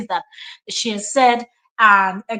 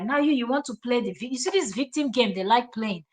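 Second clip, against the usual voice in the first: ordinary talk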